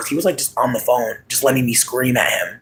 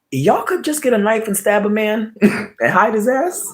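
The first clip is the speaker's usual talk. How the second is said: laughing quip; 9 semitones higher than usual. Pretend. Voicing her inner reaction.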